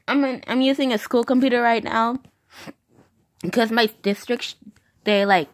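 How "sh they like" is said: ordinary delivery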